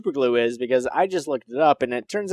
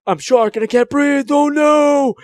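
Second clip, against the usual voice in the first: hammed-up choking shark, higher and rising into a shout; mock panic; 10 semitones higher, 3 dB louder than usual. Rival mocks the shark that can't breathe, hammed-up panic. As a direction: play the suffocating shark in over-the-top alarm. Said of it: "You're like".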